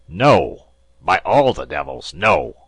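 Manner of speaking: loud angry shouted no; raging captain; furious refusal. A narrator's reading. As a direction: refuse him at the top of your lungs.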